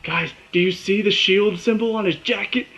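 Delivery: high-pitched, urgent alert to friends; freaking out. Retold or imitated. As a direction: re-enact a fan's agitated call for everyone to look. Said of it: "I'm freaking out at this point".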